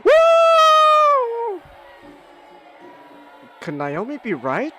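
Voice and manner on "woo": high, loud hype shouts; excited cheering. Real, not acted. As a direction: cheer like the crowd's going wild. Cheering on his fighter.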